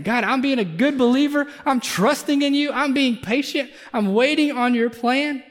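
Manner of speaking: a hammed-up believer's plea; pleading prayer. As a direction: pray it out, pressing God for an answer.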